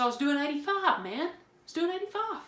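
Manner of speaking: high-pitched retold insistence; acted-out protest, rising in pitch; mock protest. Retells protesting to the officer.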